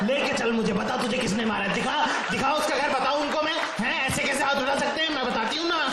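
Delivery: enraged mother demanding to be shown the bully's house, loud and high; furious mother. Retold or imitated. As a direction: storm out demanding the culprit. Furious mother marching to culprit.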